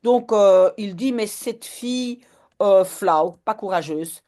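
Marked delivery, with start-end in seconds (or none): none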